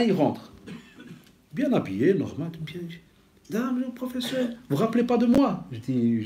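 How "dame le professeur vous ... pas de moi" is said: high, bright-eyed retold voice of the man; eager greeting. Preacher voicing the former homeless man greeting him, high and eager. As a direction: lift it up like he's thrilled to be recognised.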